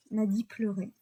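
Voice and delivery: ordinary delivery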